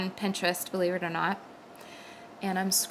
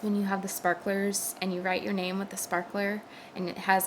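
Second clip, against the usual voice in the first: ordinary talk, nothing marked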